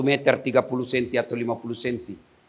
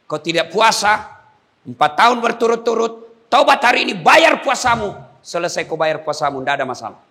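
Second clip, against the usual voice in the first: higher, louder exhortation building up; fiery preaching; 5 semitones higher, 6 dB louder than usual. Acted. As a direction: drive the call to repent home. Preacher exhorts at full force.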